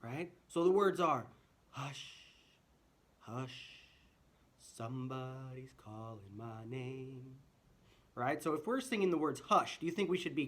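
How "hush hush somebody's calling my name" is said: soft piano whisper. Shown as a demonstration. Teacher showing children a whispered line. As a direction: hush it very softly, almost no voice. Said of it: "or a whisper".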